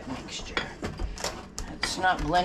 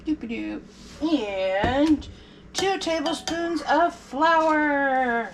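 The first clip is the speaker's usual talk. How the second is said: slow drawn-out announcer reveal; game show announcer; 8 semitones higher, much slower than usual. Pretend. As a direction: announce it like a prize. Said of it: "Sound like a game show announcer".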